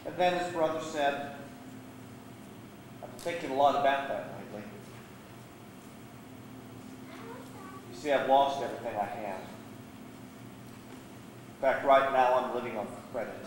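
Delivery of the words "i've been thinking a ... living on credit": low, soft and downcast; subdued confession. Acted. Younger brother confessing to brother after a silence, subdued. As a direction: admit defeat quietly.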